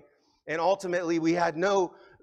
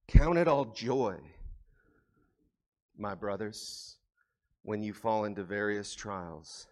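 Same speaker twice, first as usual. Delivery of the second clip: tender scripture reading; 8 semitones lower than usual. Real, not acted. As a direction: linger gently on each word. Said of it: "scripture repeated softly, slower".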